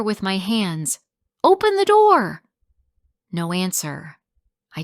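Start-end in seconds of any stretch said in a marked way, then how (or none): 1.4-2.4 much higher, much louder frantic yell; desperate shout. Acted. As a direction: scream through the locked door. Girl bangs on locked door.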